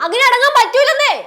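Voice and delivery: high-pitched, insistent refusal; defiant protest. Acted. Refuses to calm down when asked. (വാശിയോടെ എതിർത്ത്)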